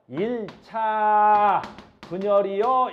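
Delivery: loud, hugely drawn-out term; teacher's slow, booming model answer; emphatic demonstration. Shown as a demonstration. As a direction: stretch 일 차 out long and loud.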